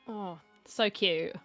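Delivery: normal talking voice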